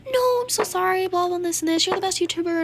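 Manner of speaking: high-pitched imitation of pleading flattery; mock apology. Girl apologizing to the YouTuber, high mocking imitation. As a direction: mimic her gushing excuses up high.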